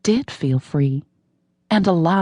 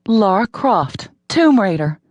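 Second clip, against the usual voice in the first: slower, higher and louder, triumphant; heroic flourish; 5 semitones higher, 4 dB louder, slower than usual. A narrator's reading. Heroine feels like Lara Croft, triumphant slow flourish. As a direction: savor the name like a proud action hero. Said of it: "She felt like".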